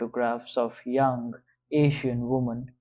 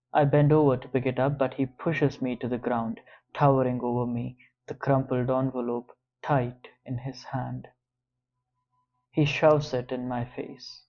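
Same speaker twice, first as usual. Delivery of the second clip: plain reading voice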